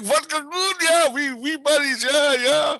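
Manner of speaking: high, loud pidgin English, over-friendly; butter up a stranger in goofy Russian English; ingratiating mock Russian. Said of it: "trying to get on his good side".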